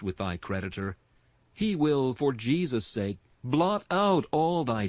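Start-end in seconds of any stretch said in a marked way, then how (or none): none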